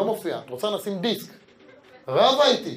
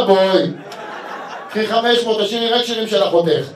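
louder, hammed-up deal making; loud haggling punchline; 4 semitones higher, 8 dB louder than usual. Husband closing the deal with the singer, loud and hammy. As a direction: close the deal like a market trader.

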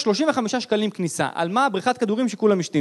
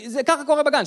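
ordinary talk, nothing marked

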